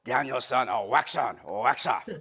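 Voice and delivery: low-pitched film-mentor impression in a Japanese accent; Mister Miyagi. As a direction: quote the old master, deep and accented. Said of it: "Remember the original?".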